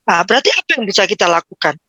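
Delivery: ordinary talk, nothing marked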